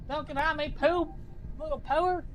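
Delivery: high, soft teasing pet voice. Real, not acted. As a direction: scold the bird sweetly. Talking to his chicken.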